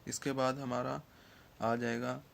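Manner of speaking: ordinary delivery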